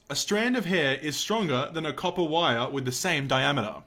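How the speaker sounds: usual speaking voice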